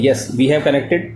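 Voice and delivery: usual speaking voice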